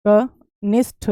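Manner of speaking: कनिष्ठ split slowly as "ka... nish... tha"; slow, puffed final tha in कनिष्ठ; slow model pronunciation. Shown as a demonstration. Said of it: "कनिष्ठ होता है". (कनिष्ठ को तोड़कर, 'ठ' पर ज़ोर)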